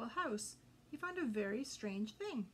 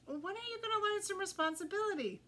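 exasperated father; 9 semitones higher, 3 dB louder, faster than usual. Acted. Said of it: "his father asked".

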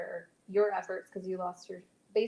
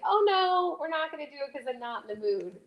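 mock excuse; 6 semitones higher than usual. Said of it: "as if this was gonna be like".

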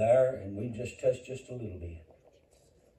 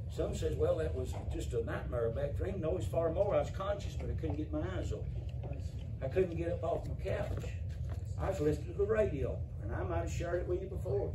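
preaching at full force; 5 semitones higher than usual. Acted. Preacher to his church, crying out about hell at full force. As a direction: drive it home to the congregation, full force.

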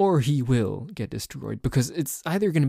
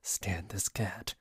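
slow hushed whisper; 4 semitones lower, 11 dB quieter, slower than usual. Acted. Whispering to partner so the cat can't hear. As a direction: breathe it so the cat won't hear. Repeats complaint under breath.

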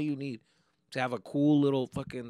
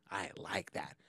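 whispered repeat of I like that; 7 semitones lower, 11 dB quieter, slower than usual. Real, not acted.

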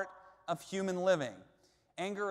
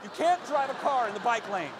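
indignant yelling; 2 semitones higher, 4 dB louder than usual. Retold or imitated. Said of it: "I'm like".

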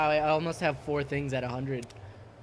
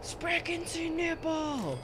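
much higher, slow mock German exclamation; slower than usual. Gamer blurting fake German at the screen, high and slow. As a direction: ham up a German accent.

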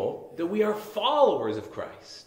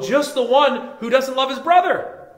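higher and louder on "brother", driving it home; raised preaching voice; emphatic point; 9 semitones higher, 7 dB louder than usual. Real, not acted. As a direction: press the point with rising force.

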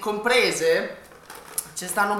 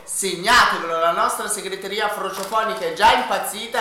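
ordinary talk

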